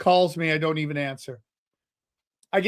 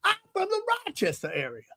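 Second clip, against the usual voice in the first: put-on high, quick, softer old-man voice; older man impersonation; 14 semitones higher, 6 dB quieter, faster than usual. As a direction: imitate the old man, pitched way up. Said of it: "It's how he talks".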